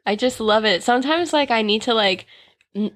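ordinary delivery

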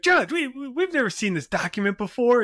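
mock the lawyer's whine to the judge; whiny lawyer. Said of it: "He'd be like".

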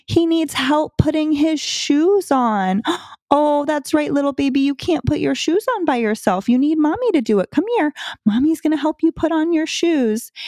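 put-on high sing-song baby talk to a kid; mother coaxing her son with his shoes; high-pitched motherese. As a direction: coo over him like a little baby. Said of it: "I did the motherese. I did the high pitch".

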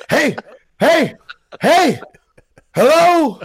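loud, high calls into the empty street; yelling hello. Pretend. Mocking a survivor yelling for people in an empty town, shouting. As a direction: call out hard to anyone who might hear. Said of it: "I'm not gonna be yelling".

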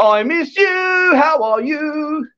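high, drawn-out playful cooing; mock lovey-dovey. Host greeting a missed friend, exaggerated sing-song cooing. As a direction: coo it slowly, hamming up the affection.

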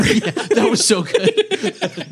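high, slow, laughing delight. Real, not acted. Podcaster cracking up over a movie line, laughing. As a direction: gush while still laughing.

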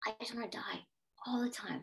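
hushed, near-whispered inner thought; soft, private admission of despair; retold despairing thought. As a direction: voice it softly, as if to yourself. Retells her private dark thought.

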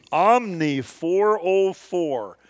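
drawn-out, naive correction; slow mock-earnest reply; slow, careful answer. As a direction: spell it out slowly like an honest fool. Friend naively giving his real room.

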